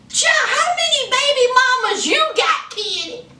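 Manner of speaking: high, fast, laughing disbelief; amused disbelief. Retold or imitated. Teasing Kenny about his seven kids, high and fast. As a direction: pile on the question, up high and quick. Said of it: "I was like".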